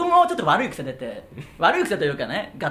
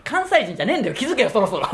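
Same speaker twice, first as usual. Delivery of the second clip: high-pitched comic scolding; exasperated retort; 5 semitones higher than usual. Acted. Comedian scolding his partner for fake Kansai, exasperated. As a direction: tell off your partner in mock exasperation.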